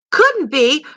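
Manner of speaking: put-on a frightened boy echoing back in panic; panicked cry. A narrator's reading. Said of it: "cried Christopher John in a panic".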